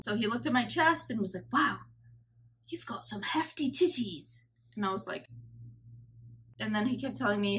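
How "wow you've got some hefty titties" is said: breathy imitated South African accent; mock South African surgeon, half-whispered; a hint of South African accent, hushed. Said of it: "doing his accent".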